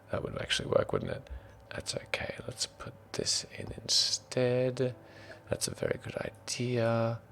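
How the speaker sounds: soft, half-whispered self-talk; thinking out loud. Real, not acted. Game master fixes the map, murmuring to self. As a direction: murmur to yourself while busy. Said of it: "thinks out loud".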